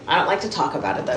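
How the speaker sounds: ordinary talk, nothing marked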